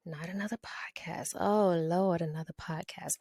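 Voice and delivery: mock-weary whisper of a groaning listener. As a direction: hush it like a tired sigh to yourself. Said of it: "So I know you thinking".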